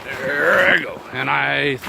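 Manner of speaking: loud, high jolt of excitement; excited burst. Real, not acted. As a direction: blurt it as you drop down. Sliding down into the creek.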